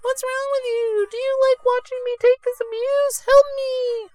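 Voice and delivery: very high, bossy squeak; squeaky hat voice. Acted. Hat orders the idle boy to help, very high squeak. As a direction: shout at the kid in a tiny strained falsetto. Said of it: "This really hurts my throat to do that voice".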